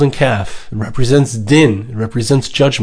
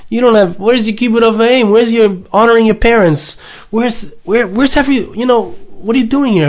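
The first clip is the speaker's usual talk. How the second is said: angels demanding what a mortal is doing here; accusing angels; 6 semitones higher than usual. Acted. Angels barring a mortal, high and accusing. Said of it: "all these angels saying".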